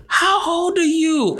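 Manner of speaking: very high drawn-out gushing question; high, slow imitated lady. Stranger at party quizzing him, very high slow mock voice. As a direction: stretch it out like a cooing aunt.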